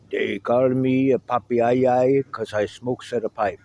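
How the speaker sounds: light Italian immigrant accent, imitated; a hint of a deep Italian accent quoting Popeye. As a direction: mimic an old Italian neighbor's Italian accent. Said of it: "with his deep Italian pipe-scented accent".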